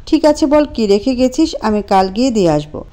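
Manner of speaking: normal talking voice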